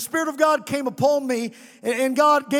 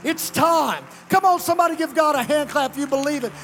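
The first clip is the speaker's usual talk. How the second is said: high, loud pulpit cry; rally the church with driving, insistent repetition; impassioned revival preaching at a sustained pitch; full-force preaching; 7 semitones higher, 4 dB louder than usual. Real, not acted.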